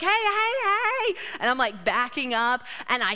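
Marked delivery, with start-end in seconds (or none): 0.0-1.1 high yelled warning at a car; shouting hey. Retold or imitated. Woman yelling at a car driver to stop.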